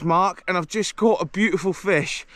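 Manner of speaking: ordinary talk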